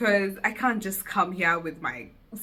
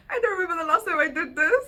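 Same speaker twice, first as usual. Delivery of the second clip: laughing; 11 semitones higher, much faster than usual. Real, not acted. Laughing through the intro.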